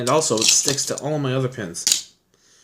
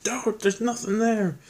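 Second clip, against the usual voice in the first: much higher, slower put-on voice; imitated friend pleading; 10 semitones higher, slower than usual. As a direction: stretch it out like a pleading buddy. Jordo repeating his warning.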